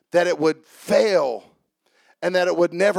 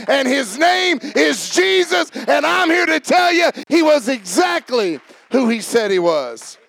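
high, driving sermon delivery; full-force preaching; 6 semitones higher, 3 dB louder than usual. Real, not acted. Preacher tells congregation Jesus is exactly who he said, full-force preaching. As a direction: build into a shouted, high-pitched proclamation.

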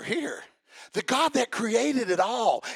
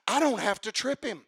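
ordinary talk, nothing marked

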